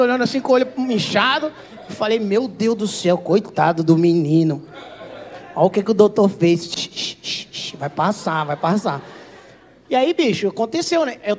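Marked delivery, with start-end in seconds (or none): 2.2-9.0 low, slow pitying coo; mock consoling. Patient consoling his swollen member, mock tenderness. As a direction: comfort it low and slow like a hurt child.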